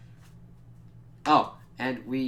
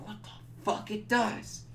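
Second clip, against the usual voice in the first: mock disbelief; 10 semitones higher than usual. Imitating surprised vampires.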